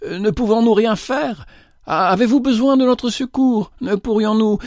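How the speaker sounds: high, worried call from a carriage; anxious girl calling. A narrator's reading. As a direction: lean out and call, eager to help. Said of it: "se pencha un peu hors de la voiture et cria".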